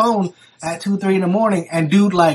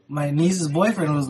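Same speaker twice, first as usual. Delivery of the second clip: ordinary talk, nothing marked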